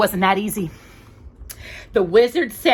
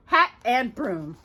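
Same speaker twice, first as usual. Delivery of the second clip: ordinary delivery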